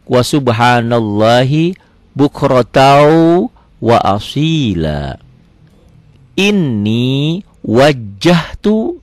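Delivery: slow recitation, holding the j in "wajjahtu"; doubled consonants. Shown as a demonstration. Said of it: "double jet".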